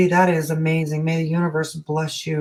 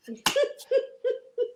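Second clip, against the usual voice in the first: high laugh; 8 dB quieter than usual. Real, not acted. Laughing at her own joke, a high burst of laughter.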